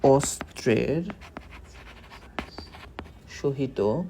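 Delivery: dictating word by word, very slow; slow dictation. Shown as a demonstration. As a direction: spell it out for notes. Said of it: "এর ব্যাস বাক্য হলো". (ধীরে ধীরে লিখিয়ে দেওয়া)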